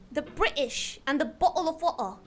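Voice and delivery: "British", "bottle" and "water" with the t's swallowed; quick glottal stops in "bottle of water". Shown as a demonstration. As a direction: say "bottle of water" as bo'ul o' wa'er. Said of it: "speak with the glottal stop".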